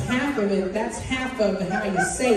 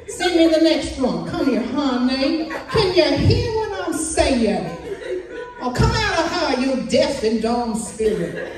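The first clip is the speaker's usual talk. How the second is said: higher, louder healer calling people forward; hammed-up faith healer; 6 semitones higher, 4 dB louder than usual. Healer at a service casting out a deaf spirit, mock preaching. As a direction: play a showy healer rebuking a spirit. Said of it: "He said".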